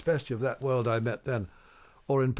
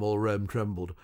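ordinary talk, nothing marked

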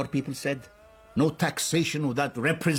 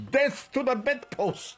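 mock shout; 9 semitones higher than usual. Prime minister joking about stubbing his toe, mock shout. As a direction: play at shouting a curse. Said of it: "I stub my toe and I shout".

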